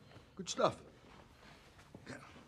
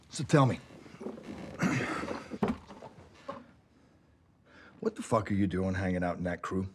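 tough cop. Acted. Cop intimidating suspect.

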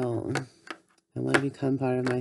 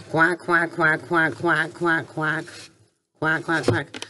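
duck quacking. Pretend. Child pretending to be ducks at the pond, quacking. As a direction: quack away like a hungry duck.

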